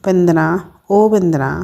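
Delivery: ordinary delivery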